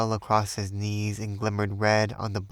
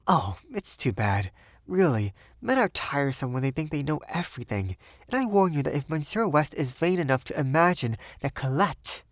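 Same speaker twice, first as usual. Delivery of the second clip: higher, teasing indignation; Sylvia, playfully indignant; 5 semitones higher than usual. A narrator's reading. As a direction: scold him playfully.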